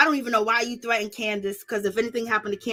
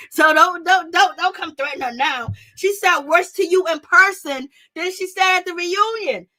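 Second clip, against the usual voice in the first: heated outburst; 5 semitones higher, 5 dB louder than usual. Real, not acted. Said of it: "heated rant climax".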